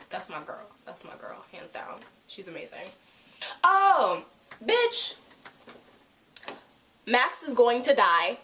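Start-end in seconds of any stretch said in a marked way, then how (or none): 0.1-2.8 very soft, fond aside; quiet affectionate fangirling; near whisper. Real, not acted. 3.6-5.2 high, loud gasp of realization; shocked realization about Max; sudden shock. Real, not acted.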